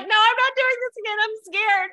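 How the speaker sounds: high, far-off frantic voice; squeaky scared retelling; panicked quote. Retold or imitated. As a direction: voice jumps up in mock panic. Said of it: "I was like".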